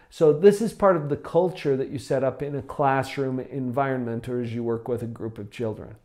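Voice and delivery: ordinary talk, nothing marked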